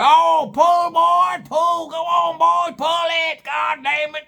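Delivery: high, strained shouted orders; hillbilly dad shouting. Acted. Dad in the tree orders his boy to pull, high frantic shout. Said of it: "you hear Daddy up there go".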